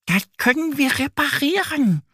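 very high-pitched duck character, comforting a friend; consoling little duck. A narrator's reading. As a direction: squeak it gently as a little duck. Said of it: "Sie möchte ihren Freund trösten".

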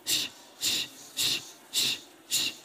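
voiceless hissed Sch, Sch repeated; sch exercise. Shown as a demonstration. Coach demonstrating breath exercise, voiceless sch. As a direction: push out Sch Sch as breath pulses. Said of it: "Oder S C H".

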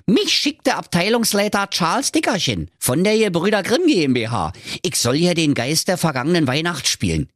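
high-pitched, grumbling little dwarf; cheeky ghost dwarf. Acted. Dwarf ghost explains his badly paid job, cheeky. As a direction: play an impatient working dwarf.

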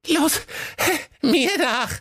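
very high goat voice, gasping; panting goat. A narrator's reading. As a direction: call it out breathlessly like Cindy. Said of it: "japste das Geißlein Cindy". (hohe Geißleinstimme, japsend)

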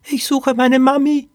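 put-on high, squeaky little-girl voice; Poppy. A narrator's reading. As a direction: peep it like a lost, helpless child. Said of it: "piepste Poppy". (hohes, piepsiges Kleinmädchenstimmchen)

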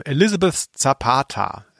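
ordinary talk, nothing marked

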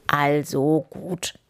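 slow bear grumble; grumbling bear. A narrator's reading. Bear gives up on the squirrels, slow grumble. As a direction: drag the words out grumpily. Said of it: "brummte der Bär".

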